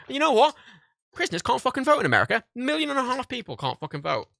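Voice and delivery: high, loud outraged exclamation; furious indignation. Real, not acted. Vlogger telling viewers prisoners can't vote, furious indignation. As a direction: throw it out high and loud, incensed. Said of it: "I hope you can hear it in my voice".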